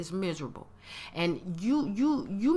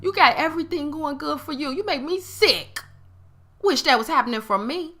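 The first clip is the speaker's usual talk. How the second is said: higher and louder, openly jealous and blunt; proud hater; 7 semitones higher, 4 dB louder than usual. Retold or imitated. Voicing a proud hater who admits envy to a friend, openly jealous. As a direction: say it straight, owning the jealousy. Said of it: "stand in their truth and be like".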